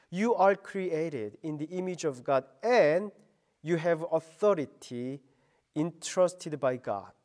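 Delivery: ordinary talk, nothing marked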